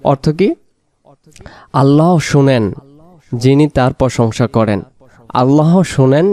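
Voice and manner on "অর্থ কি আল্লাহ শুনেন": ordinary talk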